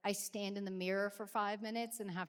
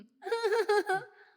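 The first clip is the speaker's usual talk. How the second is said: high loud laugh; 12 semitones higher, 10 dB louder than usual. Real, not acted. Embarrassed pause about mom.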